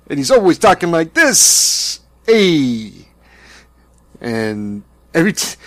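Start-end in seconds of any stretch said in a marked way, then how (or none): 0.1-2.0 put-on Brooklyn Italian accent. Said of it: "what he thinks is a Brooklyn Italian accent". 2.3-3.1 loud Brooklyn Italian "Hey"; Brooklyn Italian accent. Retold or imitated. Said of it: "what he thinks is a Brooklyn Italian accent".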